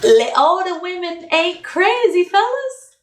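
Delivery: high, slow laughing plea; amused high-pitched appeal. Real, not acted.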